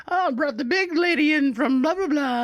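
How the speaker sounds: high mock old-lady gabble; yelling old ladies. Old ladies announce the big lady's arrival, high mock yelling. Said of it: "they're all yelling at each other".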